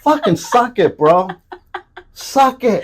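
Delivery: high, loud taunt; mock yelled dismissal. Telling an aching bro to deal with it, mock yell. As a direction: throw it out as a loud jeer.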